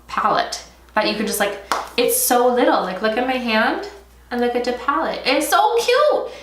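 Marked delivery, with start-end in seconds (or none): 5.3-6.3 high, drawn-out cooing over something tiny; gushing squeal. Real, not acted. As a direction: squeal with delight, stretching the words.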